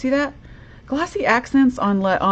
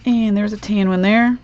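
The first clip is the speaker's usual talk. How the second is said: ordinary talk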